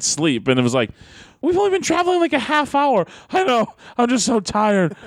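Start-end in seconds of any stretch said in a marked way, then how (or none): 1.4-3.0 very high-pitched mock disbelief; retold complaint, pitched way up in exasperation; incredulous protest. Retold car argument, incredulous reply. 3.3-4.9 high mock whine of tiredness; dramatic exhausted whining. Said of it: "He's so dramatic with it".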